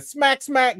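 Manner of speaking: high, quick slap noises; mock slap sounds. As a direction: smack it out like a cartoon slap.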